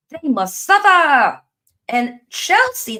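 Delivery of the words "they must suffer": loud, high, slow villain line; mock menace. Fan vowing Leicester will suffer, loud mock menace. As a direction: savour every word of revenge.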